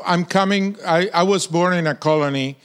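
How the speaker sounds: ordinary talk, nothing marked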